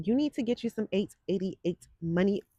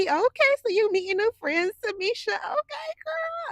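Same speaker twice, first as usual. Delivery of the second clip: excited squeal; 14 semitones higher, 5 dB louder than usual. Real, not acted. Excited reaction to viewer's news.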